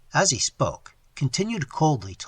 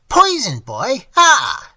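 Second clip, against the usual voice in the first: high, loud horrified shriek; 9 semitones higher, 9 dB louder, slower than usual. Acted. Old man learning he was poisoned, shrieking. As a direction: scream the word out in shock. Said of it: "shrieked the old man".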